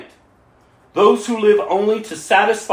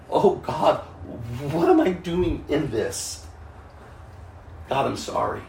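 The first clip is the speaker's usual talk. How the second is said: soft, humbled plea to God; quiet remorseful prayer; 4 semitones lower, 7 dB quieter than usual. Retold or imitated. Preacher imitates a convicted believer praying quietly. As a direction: murmur it as true sorrow.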